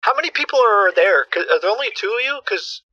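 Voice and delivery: ordinary delivery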